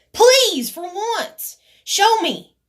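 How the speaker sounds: high, exasperated challenge with loud bursts; mad, riled up. Retold or imitated. Riled up retelling how they dared her, mad. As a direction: plead angrily, punching the opening words. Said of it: "but I'm mad. You know, I get riled up".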